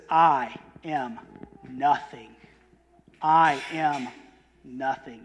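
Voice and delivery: nothing dropped low and slow; solemn repeated I am nothing; stress on nothing. Shown as a demonstration. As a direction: let each nothing fall away. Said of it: "You see the emphasis there?".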